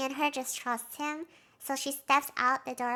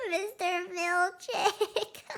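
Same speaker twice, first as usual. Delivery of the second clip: laughing; 5 semitones higher, much slower than usual. Real, not acted. Laughing at Milchick's fate.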